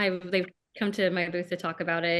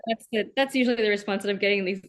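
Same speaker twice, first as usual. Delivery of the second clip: ordinary talk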